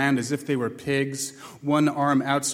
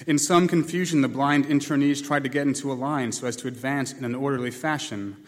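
usual speaking voice

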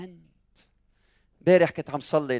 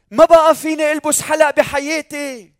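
much higher, louder mock tearful wail; imitating a crying girl; 13 semitones higher, 9 dB louder than usual. Preacher imitating a crying girl with a swollen ear, mock sob. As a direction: voice the little girl's despair, hammed-up. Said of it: "فإجت لعندي عم تبكي".

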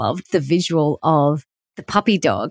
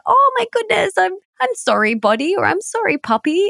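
put-on very high, tender baby-talk apology; cooing sorry to a puppy; heart-melted, sing-song sorry; melting apology; 14 semitones higher than usual. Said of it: "I immediately want to go".

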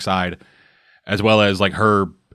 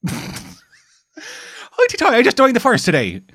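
put-on high, hammy stage-Irish accent; 12 semitones higher than usual. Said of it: "my most stereotypical Irish accent".

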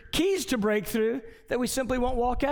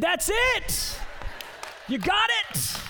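excited praise; 9 semitones higher, slower than usual. Retold or imitated. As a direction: cheer her on, thrilled she got it. Praising daughter's answer, shouting.

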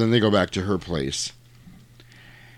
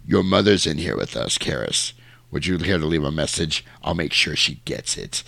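lowered, lightly voiced devil; taunting demon; 5 semitones lower than usual. Demon taunting the priest about his mother, low voice. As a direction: drop the pitch for a demon's taunt. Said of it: "and then he goes".

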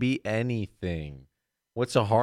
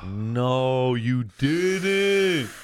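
mock outrage; 3 semitones higher, much slower than usual. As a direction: act scandalized.

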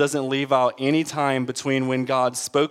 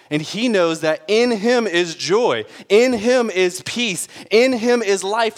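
impassioned preaching; 7 semitones higher, 5 dB louder than usual. Real, not acted. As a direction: preach it with building fervor, leaning on each phrase. Preacher builds to a peak.

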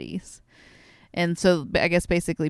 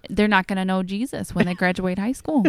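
put-on loud whiny Wisconsin mom accent; complainy Midwestern mom voice; 3 semitones higher, 8 dB louder than usual. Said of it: "my Wisconsin accent".

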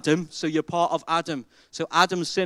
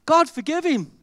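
mock naive listener; 8 semitones higher than usual. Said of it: "And you think".

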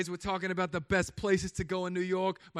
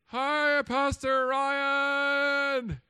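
high, loud and drawn-out fake-sober hello; a tipsy bar patron trying to sound sober to the pastor; overly sweet drunk greeting; 8 semitones higher, 5 dB louder, much slower than usual. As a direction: greet the pastor way too brightly, stretching it out.

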